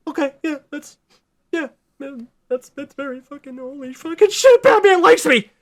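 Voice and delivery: high, giddy gushing, building to a yell; starstruck fanboy. Retold or imitated. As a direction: squeal like a fan meeting his hero. Barry voiced in fan pitch.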